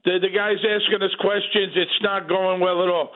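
normal talking voice

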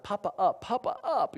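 put-on high-pitched little boy pleading to be picked up; crying toddler. Little boy reaching out to his grandpa from the playpen, imitated crying. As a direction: imitate a small child's high, tearful plea. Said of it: "he's crying and that".

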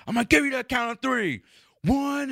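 high, cartoonish bully cop voice; mock a cocky roided-up officer; aggressive cop. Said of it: "and then he just goes".

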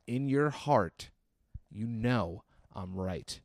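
drawn-out earnest insistence; mock-grave slow repetition; slow, solemn appeal. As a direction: press the point slowly, word by word. Same appeal repeated slowly for weight.

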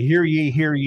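loud, high proclamation call; town crier. Acted.

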